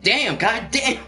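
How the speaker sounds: high-pitched impressed exclamation; imitated astonished reaction; mock amazement. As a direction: exclaim it high, like a stunned teammate. Acting teammate's amazed reaction.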